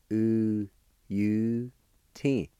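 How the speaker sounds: "U U T" as long, separate syllables; sounded out. Shown as a demonstration. Teacher showing how giblet is pronounced, sounded out. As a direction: hold each syllable of "U U T" with pauses between. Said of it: "is pronounced like this".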